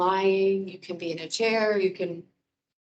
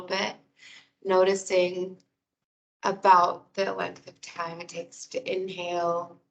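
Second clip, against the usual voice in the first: guided meditation. Real, not acted. Wellness coordinator guiding listeners through breathing and muscle release, guided meditation.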